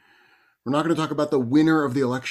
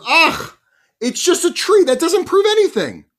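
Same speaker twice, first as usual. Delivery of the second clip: high, loud exasperated outburst; exasperated group; 10 dB louder than usual. Retold or imitated. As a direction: groan and protest loudly, fed up. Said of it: "It's getting more frustrated".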